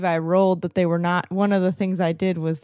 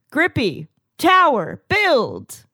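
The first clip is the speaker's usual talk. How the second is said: command; 5 semitones higher, slower than usual. Acted. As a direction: order the stapler to build, one word at a time. Commanding stapler to build.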